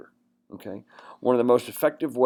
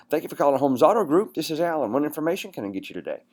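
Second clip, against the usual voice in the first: bright-pitched, brisk upbeat greeting; upbeat phone greeting; 5 semitones higher, faster than usual. Shown as a demonstration. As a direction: answer the call upbeat, quick at the end. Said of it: "you gotta be upbeat".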